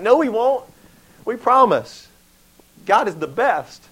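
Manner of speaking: loud, high-pitched earnest promise; acted eager insistence. Israelites promising Joshua they won't turn, hammed-up eagerness. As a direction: protest like an over-keen crowd.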